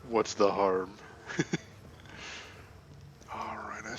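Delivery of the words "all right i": whisper. Real, not acted. Whispered aside.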